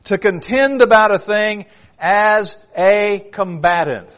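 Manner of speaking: "to contend about a thing as a combatant" read slowly, every word weighted; slow emphatic reading. Shown as a demonstration. Preacher reads a definition to his flock, slow and weighty. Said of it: "listen carefully".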